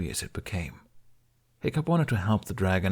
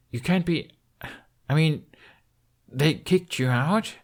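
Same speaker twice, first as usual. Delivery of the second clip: higher, disbelieving, slowing at the end; stunned disbelief, climbing up; lightly voiced shocked Hiccup; 7 semitones higher than usual. Hiccup learning Toothless was cast out, shocked.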